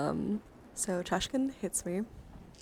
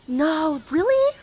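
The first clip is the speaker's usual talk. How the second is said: loud, high gasping "no, really?"; gasp, then cry out in rising disbelief; startled high outburst; real shocked disbelief; 8 semitones higher, 14 dB louder, much slower than usual. Real, not acted. Startled reaction to mishap on stream.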